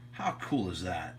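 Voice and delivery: usual speaking voice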